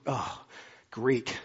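ordinary talk, nothing marked

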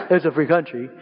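chuckling imitation of a kid; laughing mimicry. Pastor laughing as he quotes younger siblings. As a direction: laugh through the kids' line. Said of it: "that's how they talk".

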